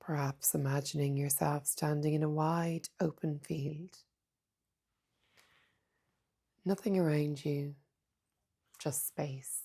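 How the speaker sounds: soft, unhurried meditation voice with long pauses; hushed, calm mindfulness guidance; guided meditation. Acted. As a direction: guide them gently inward, leaving space between phrases.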